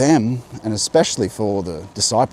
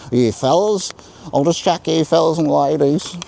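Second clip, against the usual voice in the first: general Australian accent, higher and louder; 7 semitones higher, 5 dB louder than usual. Shown as a demonstration. Vlogger showing viewers a general Australian accent. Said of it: "In general Australian accent".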